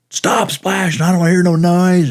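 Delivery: put-on high, loud yell at the kids in the pool; grumpy neighbor yelling at splashing kids; retold yell. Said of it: "and he'd yell".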